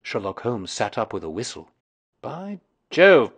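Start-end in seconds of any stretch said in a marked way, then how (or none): 2.2-3.4 surprised. A narrator's reading. Holmes surprised by the stone.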